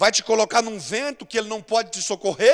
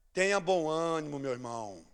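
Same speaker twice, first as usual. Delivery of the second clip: tender encouragement; 8 semitones lower, 7 dB quieter than usual. Real, not acted.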